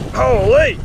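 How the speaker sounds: surprised. Real, not acted. Fisherman hit hard again, high surprised yelp. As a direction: cry out as the fish thumps you.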